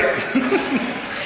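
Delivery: short breathy high chuckle; airy laugh. Real, not acted. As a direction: let out a pleased little laugh. Laughs after the story.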